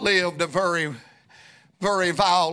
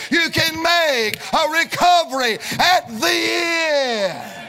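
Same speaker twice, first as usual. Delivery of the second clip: preaching at full force; 3 semitones higher than usual. Acted. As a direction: preach it like a tent revival, pushing every line. Revival preaching climax.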